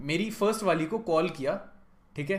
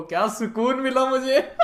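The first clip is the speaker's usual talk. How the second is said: higher, louder, slow gloating through laughter; gleeful laugh; 11 semitones higher, 10 dB louder, slower than usual. Real, not acted. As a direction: savour it, laughing with glee. Savouring ex's regret. (हँसते हुए, ऊँची आवाज़ में मज़े लेते हुए)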